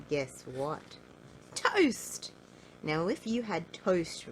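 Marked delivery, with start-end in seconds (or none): 1.5-2.3 high, loud burst; spring the surprise on the listener; high loud cry of surprise; excited announcement. A narrator's reading. Narrator announces surprise food.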